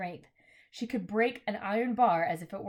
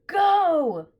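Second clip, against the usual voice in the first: loud, high urgent yell; shout; 9 semitones higher, 10 dB louder than usual. A narrator's reading. Diggory shouting to Polly, urgent yell. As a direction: bark the order to go. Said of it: "shouting to Polly".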